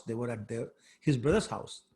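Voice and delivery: normal talking voice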